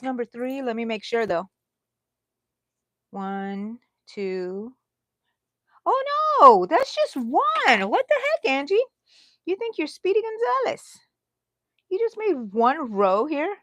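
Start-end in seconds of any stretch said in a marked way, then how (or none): 5.8-13.6 much higher, louder teasing self-reprimand; mock scolding herself. Crocheter teasing herself, Angie, for rushing a row, mock scolding. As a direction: tease yourself like a cheeky friend.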